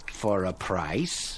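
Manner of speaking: low, slow menacing; cruel. Acted. Prospector taunting his thirsty partner, low and slow. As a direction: let the price sink in slowly.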